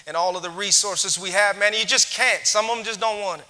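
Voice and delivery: loud skeptical complaint. Retold or imitated. A doubter in the crowd objecting loudly and exasperated. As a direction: push back hard, fed up with stubborn people. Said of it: "someone here is saying".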